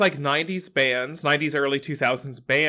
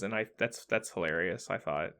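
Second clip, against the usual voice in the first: ordinary delivery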